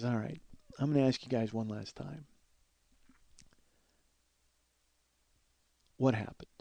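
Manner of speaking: quiet, measured retold interrogation; stern. Dan takes the two boys aside for one last question, quietly stern. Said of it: "he was very stern with me".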